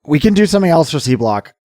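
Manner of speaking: barely-there voice forced up high; rough voice. Real, not acted. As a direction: push through a rough, worn-out voice.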